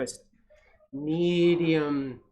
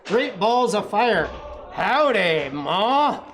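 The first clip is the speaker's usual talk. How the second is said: cowboy greeting; 5 semitones higher, 6 dB louder, slower than usual. A narrator's reading. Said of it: "Of course".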